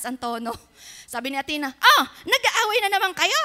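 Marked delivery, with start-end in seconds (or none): 1.7-3.4 put-on high, loud, laughing child voice; a kid catching her parents arguing, higher and louder; child's teasing scold. (batang nanunukso, mataas at malakas na boses)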